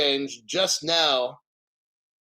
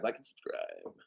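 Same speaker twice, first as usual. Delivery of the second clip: a soft throwaway repeat; muttered echo; 7 semitones lower, 16 dB quieter than usual. Real, not acted. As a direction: repeat it much softer and lower under your breath. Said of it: "repeated plea".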